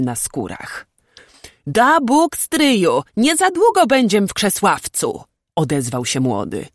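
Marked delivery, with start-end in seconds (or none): 1.7-5.2 higher, louder eager voice; keen youthful outburst; hopeful young man. A narrator's reading. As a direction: speak up hopefully to an elder. Young nobleman hopefully addresses his uncle.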